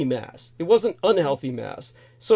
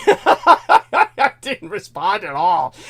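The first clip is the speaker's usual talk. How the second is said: slow, louder with laughter; laughing; 3 semitones higher, 5 dB louder, much slower than usual. Real, not acted. Admitting to a friend he stayed silent, laughing. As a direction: laugh through the words slowly.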